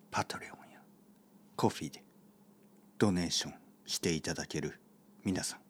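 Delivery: hushed, earnest gratitude with long pauses; real heartfelt thanks, quiet and unhurried; sincere thanks. Real, not acted. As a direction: speak softly and sincerely, weighing each phrase. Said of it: "冗談ではありません。 これは嘘ではありません。 本当の気持ち".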